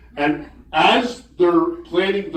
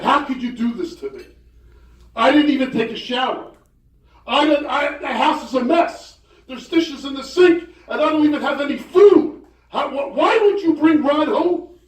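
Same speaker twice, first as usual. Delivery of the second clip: high, upset wife ranting about the mess; mock outrage, voice climbing high; wife scolding her husband for bringing a guest home unannounced, high and upset; furious wife; 11 semitones higher than usual. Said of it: "Now she's furious".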